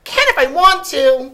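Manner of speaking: put-on very high, loud childish defiance; defiant little brother. A narrator's reading. Little brother defying Sophie, high and loud. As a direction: dig in like a stubborn toddler. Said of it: "he said".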